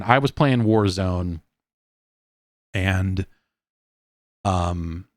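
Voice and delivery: ordinary delivery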